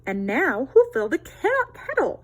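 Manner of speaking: lightly voiced busy hen; little red hen asking. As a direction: ask it like a busy mother hen. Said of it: "she asked".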